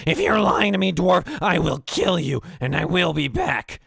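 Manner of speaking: high, loud threat; threatening goblin. Acted. Goblin boss threatening the dwarf, loud and high. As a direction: promise to kill him. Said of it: "he goes".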